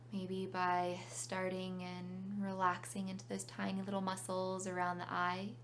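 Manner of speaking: calm meditation guide with long pauses; unhurried phrases, leaving room to breathe; guided meditation. Real, not acted.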